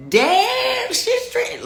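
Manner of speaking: very high, loud, drawn-out amazement; acted stunned whoop; astonished outburst. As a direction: exclaim it in squealing disbelief. Acts out amazement at conference.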